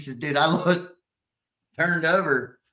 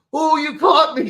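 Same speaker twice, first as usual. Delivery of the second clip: much higher, louder acted yelp; mock startled outburst; 12 semitones higher, 7 dB louder than usual. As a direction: imitate a man caught in the act. Acting out the caught man.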